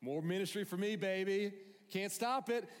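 very high, loud incredulous voice; hammed-up disbelief at his good luck; Paul thrilled at the threat of death; delighted Paul. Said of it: "They're like".

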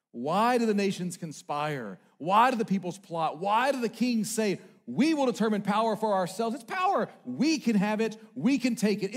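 forceful scripture recital; proclaimed psalm. Acted. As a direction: declaim it to the nations. Reading psalm two aloud.

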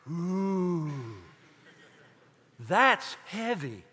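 lightly voiced awe, slow and drawn out; awed disciples. Imagined disciples reacting to the new commandment, mock awe. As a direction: draw it out, mock-impressed.

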